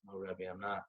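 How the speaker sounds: soft, meek admission; sheepish husband. Retold or imitated. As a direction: mumble it humbly. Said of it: "He goes".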